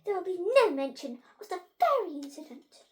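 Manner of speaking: high, forceful telling-off; hissed rebuke. A narrator's reading. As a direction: shut the subject down sharply and loudly. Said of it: "hissed Miss Einstein".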